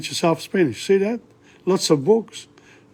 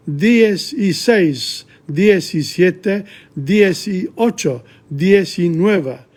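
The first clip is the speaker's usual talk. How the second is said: slow counting; 2 semitones higher, much slower than usual. Shown as a demonstration. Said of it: "counting slowly for learners".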